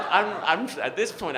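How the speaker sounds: ordinary delivery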